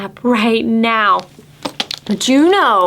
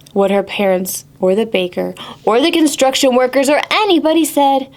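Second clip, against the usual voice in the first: plain reading voice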